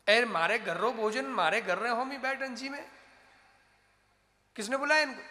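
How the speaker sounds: loud, high-pitched angry bellow; acted fury of a householder; enraged shouting. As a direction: storm at the uninvited guests. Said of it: "आग बबूला हो गया".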